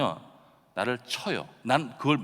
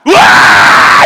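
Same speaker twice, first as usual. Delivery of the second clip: very loud, high, sustained wail; hammed-up screaming baby, full blast; imitated baby's bawl; 21 dB louder, much slower than usual. Pastor repeating his baby's cry, enormous high scream. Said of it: "내 아이는 어떻게 우는지 아세요?".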